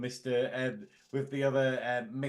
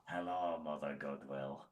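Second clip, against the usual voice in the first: low, soft, slow put-on greeting; deep hushed mock formal hello; mock posh greeting; 10 semitones lower, 10 dB quieter than usual. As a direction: greet her with mock solemnity. Mock greeting to friend's mother.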